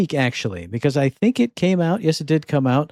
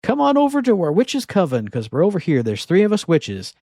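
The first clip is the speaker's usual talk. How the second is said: higher, chirpy sales pitch from a witch coven; 6 semitones higher than usual. Retold or imitated. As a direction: read it like a cheery recruiting ad. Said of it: "You answer an ad for like".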